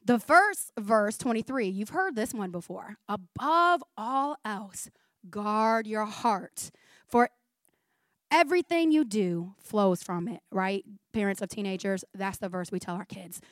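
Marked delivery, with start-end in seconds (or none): 3.1-10.4 very slow, each phrase weighed; read slowly. Shown as a demonstration. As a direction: let every word sink in slowly. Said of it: "I'm gonna read it slowly so it sinks in".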